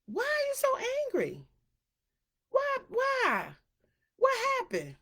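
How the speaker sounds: high, nagging mock plea; mock concerned questioning. To angry people on social media, high mock nagging. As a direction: pester them in a piping voice. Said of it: "It's just like".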